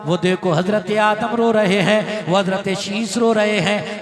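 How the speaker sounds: loud, driving preacher's litany; impassioned preaching. Acted. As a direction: build the list with rising fervour. Fervent sermon list of weeping.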